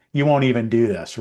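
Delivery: usual speaking voice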